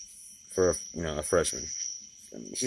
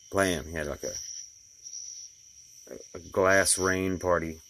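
ordinary talk, nothing marked

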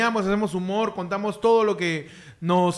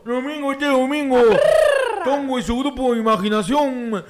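monster truck ad. Acted. Monster truck style hype intro.

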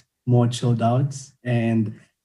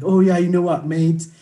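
mock British accent; 6 semitones higher than usual. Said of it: "people who come with proper British accent".

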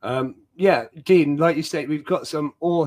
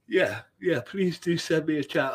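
talking through a yawn; words stretched by a yawn; 6 semitones higher than usual. Real, not acted. Quoted reply while yawning.

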